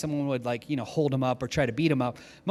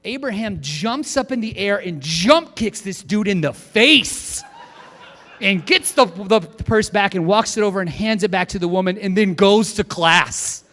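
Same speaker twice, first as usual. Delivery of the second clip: louder, breathless gushing story; excited retelling; 3 semitones higher, 6 dB louder than usual. Retold or imitated. Girl insisting to the class about the jump kick, loud and thrilled. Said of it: "she goes".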